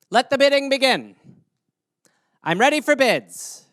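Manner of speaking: loud, high, eager pitchman voice; mock Shark Tank pitch. Entrepreneur pitching on Shark Tank, hammed-up salesman voice. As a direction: sell it hard to the investors. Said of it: "Imagine taking that to Shark Tank".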